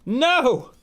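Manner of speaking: sharp high "No!"; loud protest. Real, not acted. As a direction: shout a playful protest. Mock outrage at teasing kid.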